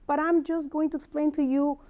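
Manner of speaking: normal talking voice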